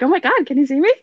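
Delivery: a ghost thrilled to be noticed, calling out; all excited. Retold or imitated. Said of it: "Like, all excited".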